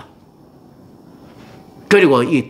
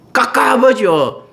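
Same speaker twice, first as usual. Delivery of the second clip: frustrated sigh; 9 semitones higher, much slower than usual. Acted. Preacher voicing trapped frustration.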